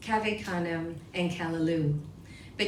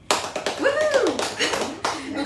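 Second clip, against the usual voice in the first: long, high, loud woohoo; cheering whoop; 7 semitones higher, 5 dB louder than usual. Real, not acted. Host cheering a poet's Swim Every Day credit, drawn-out whoop. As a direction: break into a celebratory whoop.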